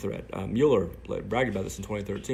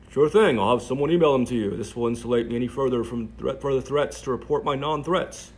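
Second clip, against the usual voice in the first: own voice, mocked; 4 semitones higher, 9 dB louder than usual. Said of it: "doing her voice and my voice".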